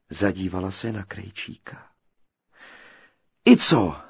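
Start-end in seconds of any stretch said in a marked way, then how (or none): 3.4-4.0 a bold, louder "so what"; defiant. A narrator's reading. Tailor brushing off the girl's doubts, defiant and louder. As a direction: shrug off her doubt, undaunted. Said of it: "nedal se Matýsek".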